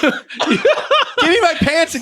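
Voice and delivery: very high, loud, drawn-out mock outrage; hammed-up yell. Woman throwing the wrestler out, hammed-up yell. Voicing Elizabeth ordering Hulk out.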